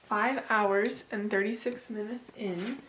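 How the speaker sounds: ordinary delivery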